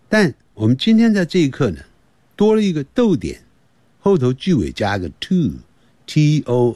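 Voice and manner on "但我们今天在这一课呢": ordinary delivery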